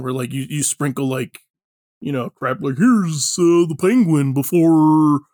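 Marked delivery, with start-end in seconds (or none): 2.8-5.2 loud, slow, drawn-out mock fanfare; mock showy reveal. Comic fan parodies Gotham stories sprinkling in references, mock showy. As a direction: ham up a corny cameo reveal. Mocking hackneyed Batman origin references.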